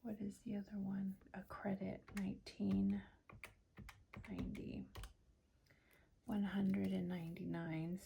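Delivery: whispering. Real, not acted. Whispering while entering amounts.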